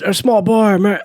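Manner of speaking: Biden impression. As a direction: stumble over words like Biden. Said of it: "When he was doing that".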